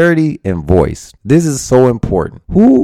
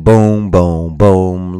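beatboxed beat; 4 semitones lower, much slower than usual. Shown as a demonstration. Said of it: "reminds me of that Rob Jackson".

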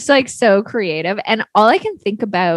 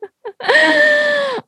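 shrieking laugh. Real, not acted. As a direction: laugh until it turns to a shriek. Laughing after exercise.

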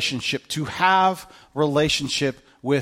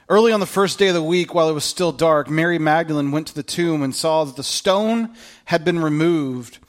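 raised, earnest reading voice; scripture reading; 2 semitones higher, 3 dB louder than usual. Acted. Pastor reading John to church, earnest reading voice. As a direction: read the gospel out to the congregation. Reads John chapter twenty aloud.

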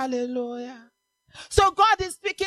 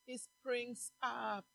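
hushed preaching; 4 semitones lower, 7 dB quieter than usual. Acted. Preacher softly telling the church the word is near, hushed. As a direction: draw them in quietly.

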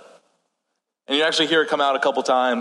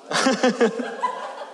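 short laugh; 8 semitones higher than usual. Real, not acted. Laughs at own joke about snakes.

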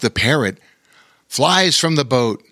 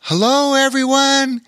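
calling out; 13 semitones higher, 3 dB louder than usual. A narrator's reading. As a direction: call to everyone from a boat. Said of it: "came a call from the boat".

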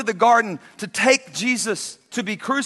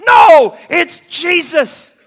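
loud joyful recognition; 8 semitones higher, 11 dB louder, much slower than usual. Acted. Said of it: "he says".

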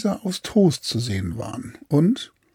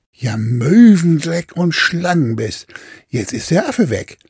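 angry curse; 4 semitones higher, 7 dB louder than usual. Acted. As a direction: swear loudly at the lost monkey. Captain flucht über den Affen. (wütend fluchender Kapitän)

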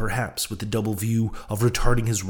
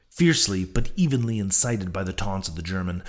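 usual speaking voice

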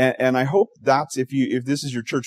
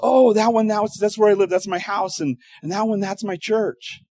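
hammed-up castaway, pitched way up and proud; 10 semitones higher than usual. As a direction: show off the huts in a high, eager voice, slowing at the end. Castaway shows his huts.

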